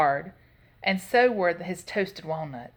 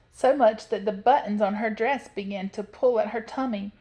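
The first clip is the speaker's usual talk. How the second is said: usual speaking voice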